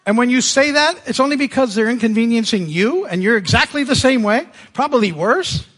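loud teasing conviction; emphatic. Real, not acted. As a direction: call the whole room out. Preacher calling out the congregation.

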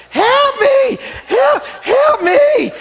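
put-on high, loud, slow child wail; wailing toddler. Imitating grandson crying for help.

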